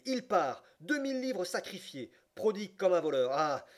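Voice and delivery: high, loud exclamations of frustration; indignant cry. A narrator's reading. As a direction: cry out, exasperated at being outdone. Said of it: "s'écria-t-il".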